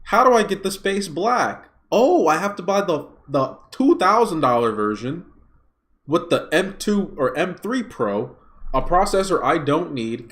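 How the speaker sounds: high, loud, mocking customer voice; hammed-up shocked customer; mock shocked buyer. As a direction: play the buyer as a whiny fool.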